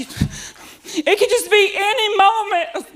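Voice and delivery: high and slow, a hint of fearful kid; scared daughter. Daughter in the waves, a touch of dread, slow and high. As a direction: drag it out in dread.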